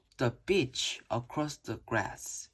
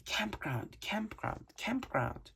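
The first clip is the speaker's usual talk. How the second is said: soft whispered repetition, barely voiced; 7 semitones higher, 7 dB quieter than usual. A narrator's reading. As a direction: whisper it right into her ear. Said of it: "I whispered in Kyla's ear".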